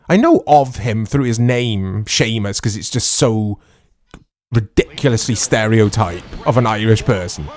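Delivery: ordinary talk, nothing marked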